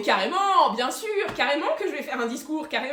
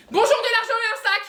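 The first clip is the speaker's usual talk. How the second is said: much higher and louder, words jumbled; panicked blurt; 10 semitones higher, 8 dB louder than usual. Acted. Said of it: "C'est pas ça qu'il fallait que je dise".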